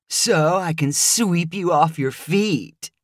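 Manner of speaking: slow, smug rehearsed pickup line; practiced pickup line. Acted. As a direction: deliver it like a well-rehearsed joke. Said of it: "repeated a line he had obviously practiced".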